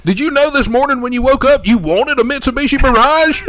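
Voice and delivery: high, loud brash pitch; hammed-up pitchman. Parodying a dealer's ad, loud brash pitch. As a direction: bark it like a no-limits dealer.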